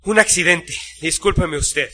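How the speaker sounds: winded, in short broken phrases; out of breath. A narrator's reading. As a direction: catch your breath between brief apologetic phrases. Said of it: "con voz agitada y falto de aire". (voz agitada, falto de aire)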